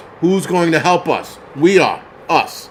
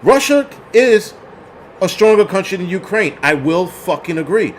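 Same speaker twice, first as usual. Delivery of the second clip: ordinary talk, nothing marked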